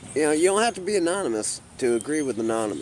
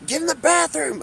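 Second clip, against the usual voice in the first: high, louder mock yell of a stressed mother; imitating angry yell; 14 semitones higher, 4 dB louder than usual. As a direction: do the lady yelling at her kid, pitched way up. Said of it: "you can hear the stress and the anger".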